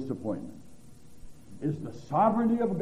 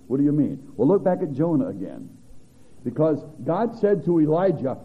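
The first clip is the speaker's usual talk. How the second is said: full-force preaching; 9 dB louder than usual. Acted. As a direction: thunder out God's questions to Jonah.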